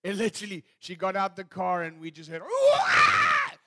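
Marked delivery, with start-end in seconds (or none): 2.4-3.5 very high, very loud imitated scream of fright; primeval scream. Preacher imitating daughter's primeval scream in a dark garden, very high loud shriek. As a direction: shriek it out like a panicked kid in the dark. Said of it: "Jemima's primeval scream in the garden".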